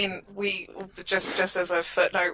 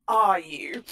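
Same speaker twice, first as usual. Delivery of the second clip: breathy disbelieving whisper; mock doubt, barely voiced; incredulous aside. Host reacting to a dubious applicant, a skeptical whispered question. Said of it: "And I was like".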